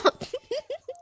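soft, very high giggle. Real, not acted. Streamer coaxing a viewer for a gift, giggling. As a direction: let out a small high-pitched giggle.

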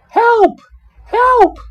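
high, loud frightened cries for help; crying for help. A narrator's reading. Miss Muffet cries out to be saved, in a panicked scream. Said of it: "cried Miss Muffet".

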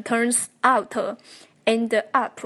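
turns out, end up split, with extra vowels: "turns out-uh, end-uh up-uh"; unlinked, dragged endings. Shown as a demonstration. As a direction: say turns out, end up word by word, adding "uh". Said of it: "不要读成".

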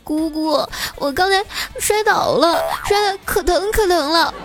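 put-on crying child. A narrator's reading. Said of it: "哭着对我说".

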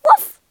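one loud, high woof; short, sharp imitated bark; dog bark. A narrator's reading. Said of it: "she barked".

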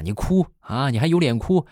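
put-on a mother berating a crying kid, each repeat hammered; mother yells at the crying child as she hits him; mom scolding. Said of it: "我妈就是一边揍一边就骂".

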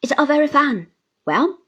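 dismissive. A narrator's reading. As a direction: brush her tears aside. Man shrugs her off.

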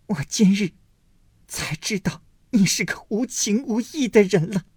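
hurt accusation. A narrator's reading. Daiyu accusing Baoyu.

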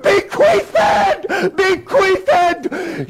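very high, frenzied headmaster shriek; unhinged, full-pitch screeching; hysterical scream. Acted. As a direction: scream each repeat in mad outrage. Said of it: "he began to scream".

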